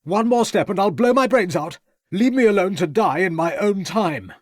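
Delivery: agitated youth threatening; desperate threat. A narrator's reading. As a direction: despair shouted at the searchers.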